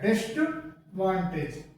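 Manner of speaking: "डिस्टवांटेज" run together, "dist-vantage"; correct disadvantage pronunciation. Shown as a demonstration. Teacher drilling students, "डिस्टवांटेज" joined as dist-vantage. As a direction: say "डिस्टवांटेज" with no "ad", a t after dis. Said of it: "ये सही है".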